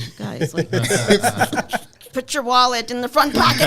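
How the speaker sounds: loud, high, laughing whisper-warning to family. Retold or imitated. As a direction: giggle through the tip about wallets. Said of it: "in our language".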